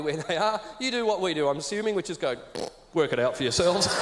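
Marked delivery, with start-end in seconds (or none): 2.9-3.9 dismissive. Retold or imitated. Dismissive Aussie to lost tourists.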